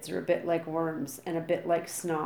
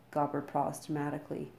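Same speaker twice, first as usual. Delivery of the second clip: usual speaking voice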